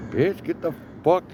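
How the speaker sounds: high, loud snapped command; angry yell. Retold or imitated. To the woman wrecking his window, an angry high yell. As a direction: bark her off the car. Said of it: "I'm just like".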